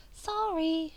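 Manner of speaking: put-on high, piping Capitol accent on Sorry. A narrator's reading. Venia waxing Katniss's leg, in a piping Capitol accent. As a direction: chirp it like a silly Capitol stylist in a Capitol accent. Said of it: "she pipes in her silly capital accent".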